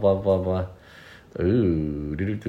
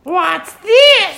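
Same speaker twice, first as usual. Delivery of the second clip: put-on very high squeaky penguin girl question; cute cartoon penguin, pitched way up; 5 dB louder than usual. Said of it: "your girl penguin voice".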